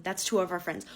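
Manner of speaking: ordinary talk, nothing marked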